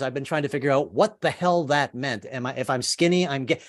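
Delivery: normal talking voice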